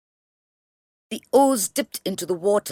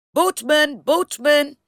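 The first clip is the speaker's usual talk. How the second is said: loud, high call over the water; calling out across the river; 8 semitones higher, 4 dB louder, slower than usual. A narrator's reading. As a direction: shout to the boatman on the far bank. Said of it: "calling out to him".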